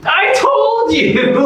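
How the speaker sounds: high shouted I told you; triumphant shout. Acted. Insisting nobody was there.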